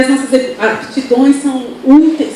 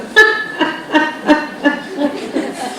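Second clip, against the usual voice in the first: soft voiceless laugh down the phone; breathy laugh; 2 semitones higher, 5 dB quieter than usual. Retold or imitated. Pastora laughing at Luciano's question on the phone, soft airy laugh. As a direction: let out a quiet airy laugh. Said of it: "uma risada".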